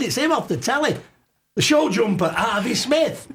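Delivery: high, excited telling friends; imitating his tipsy self telling his mates, high. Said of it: "I said".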